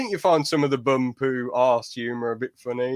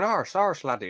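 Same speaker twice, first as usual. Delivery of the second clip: a light mock Irish accent, higher; 3 semitones higher than usual. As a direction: toss off Arse, arse, laddie in mock Irish. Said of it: "like an Irish person going".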